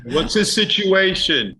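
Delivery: high, slow put-on voice; pry high and slow like a gossip; mock nosy question.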